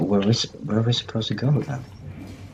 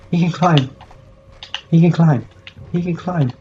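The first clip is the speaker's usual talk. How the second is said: high, loud, repeated outbursts; loud high-pitched yelps, easing slightly; frantic exclaiming; 7 semitones higher, 6 dB louder than usual. Real, not acted. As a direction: chant it like a frantic mantra. Frantic repeated cheering during scary climb.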